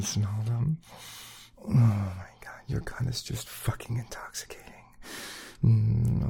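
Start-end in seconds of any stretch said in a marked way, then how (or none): 1.6-4.7 breathy intimate whisper, quick. Acted. To his partner, hungry whisper. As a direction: lean in and whisper the want.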